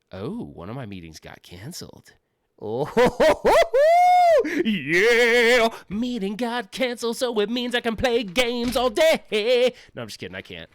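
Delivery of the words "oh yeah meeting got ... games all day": high, loud hammed-up whoop, slowing at the end; mock celebration. Mock cheering to viewers over a cancelled meeting, hammed-up and high. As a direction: cheer like the workday just vanished.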